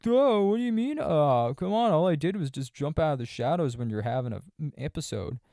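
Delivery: high, loud put-on whiny voice; mocking the fiance. Fiance brushing off jumping out of the shadows, high mocking voice. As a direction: play him as a smug creep brushing it off. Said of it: "And he's like".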